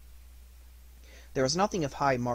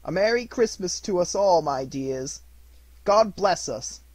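Bob's toast; 6 semitones higher, 8 dB louder than usual. Acted. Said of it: "Then Bob proposed".

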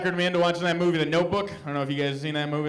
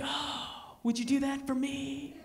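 put-on breathy pleading girlfriend, higher and slower; hammed-up girlfriend voice asking sweetly; mock girlfriend; 7 semitones higher, 7 dB quieter, slower than usual. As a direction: coo it like a smitten girlfriend. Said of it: "She's like".

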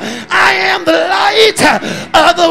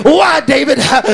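ordinary talk

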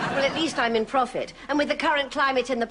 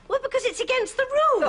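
higher, pitched-up huffy objection; indignant protest; 11 semitones higher, faster than usual. Acted. Arguing with her mother over Monopoly, indignant and pitched up. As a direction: squeak out the rules like it's obvious. Indignant reply in game quarrel.

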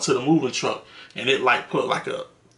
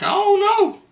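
high, played-dumb denial; mock innocent shrug; feigned innocence. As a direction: act totally clueless about the torn couch. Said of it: "I was like".

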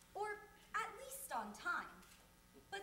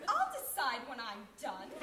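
loud, lower-pitched comic assertion; mock indignation; 4 semitones lower, 8 dB louder than usual. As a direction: put your foot down, loudly. Acted outrage at automatic toilet.

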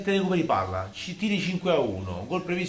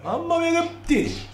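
much higher, slow amazement; amazed exclamation; 14 semitones higher, slower than usual. Real, not acted. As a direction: gasp in awe. Amazed at shots.

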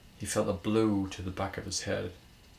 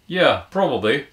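loud, higher, cheeky admission; smirking; 6 semitones higher, 12 dB louder than usual. A narrator's reading. Man chancing a flirty reply, loud and smirking. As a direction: own up boldly with a smirk. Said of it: "he smirked".

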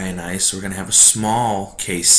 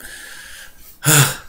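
sigh; 8 semitones higher, 3 dB louder than usual. Real, not acted. Sigh over forgotten microphone.

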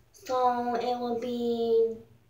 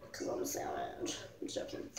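secret whisper, joking; whispered aside; 17 dB quieter than usual. Real, not acted. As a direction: whisper it as a cheeky confession. Joking aside about skipping class.

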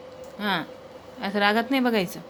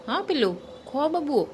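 higher, softer coaxing pet talk; 4 semitones higher, 4 dB quieter than usual. Real, not acted. Owner coaxing dog Jimmy to eat, soft baby talk. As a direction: coo at the puppy to eat.

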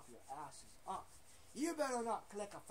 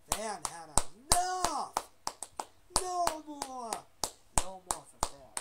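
angry outburst; 4 semitones higher, 8 dB louder than usual. Pretend. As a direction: put your foot down as the upset fan. Fan voiced angrily.

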